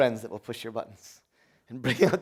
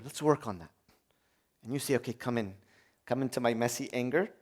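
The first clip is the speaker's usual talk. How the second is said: gentle friend; 3 semitones lower, 8 dB quieter than usual. Pretend. Gentle friend voiced in prayer.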